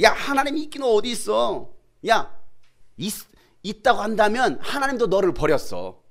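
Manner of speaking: loud, high-pitched mocking challenge; jeering taunt. Mockers to someone in hardship, loud jeering. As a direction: taunt loudly, as if rubbing it in. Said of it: "비아냥하고 비웃고 조롱합니까".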